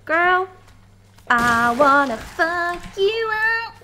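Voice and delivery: mock threat, loud and drawn out; mock menacing attacker. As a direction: play the threatening monster at full volume. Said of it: "He's like".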